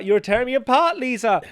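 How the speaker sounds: high, loud overwrought cry; hammed-up Tommy line. Quoting The Room's famous line to a friend, high and loud. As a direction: belt it out like a melodramatic bad actor.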